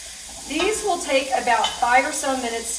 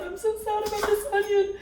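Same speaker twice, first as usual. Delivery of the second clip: hammed-up whine pitched way higher; mock sad; 11 semitones higher than usual. Crying over the onion to viewers, hammed-up high mock sadness. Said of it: "oh, here come the tears".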